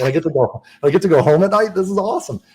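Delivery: high, loud joyful quote; thrilled disbelief. Retold or imitated. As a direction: burst out with delight at the offer. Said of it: "And I was like".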